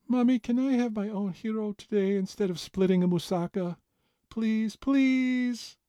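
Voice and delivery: put-on high pleading six-year-old; eager begging little girl. A narrator's reading. Child character pleading.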